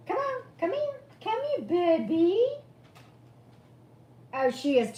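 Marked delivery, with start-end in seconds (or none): 0.1-2.5 high sing-song coaxing, building louder; calling the dog to come up, high and sweet. Real, not acted. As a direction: coax a reluctant pet over. Coaxing her dog over.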